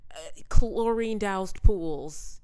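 slow and loud, leaning on every word; chlorine-doused pools stretched out slowly and loudly; drawn-out emphasis. Real, not acted. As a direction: savor each word loudly.

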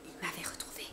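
soft whispered dread; terrified whisper. A narrator's reading. Narrator recaptured by the invisible, whispering in dread. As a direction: breathe it out in horror.

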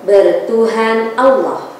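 strong, rhythmic recited pledge; declamatory pledge. Acted. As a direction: proclaim each line firmly. Leads pledge and prayer.

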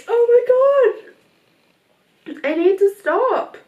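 real crying, trying to pull herself together. Real, not acted. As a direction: push the words out through sobs. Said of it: "I keep crying".

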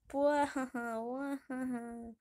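hammed-up wailing that fades away; mock sobbing. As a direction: break into cartoon boo-hoos. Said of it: "We're allergic to sadness".

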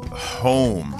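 ordinary delivery

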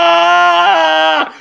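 long, loud, high comic scream; mock horrified scream. Comedian acting the developer seeing his finished game, mock horrified scream. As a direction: scream in hammed-up horror. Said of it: "just looks at it and just goes".

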